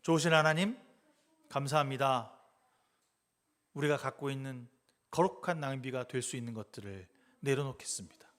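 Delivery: earnest spoken prayer. Acted. Pastor leading the closing prayer to God, earnest prayer. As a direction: pray aloud, laying things down.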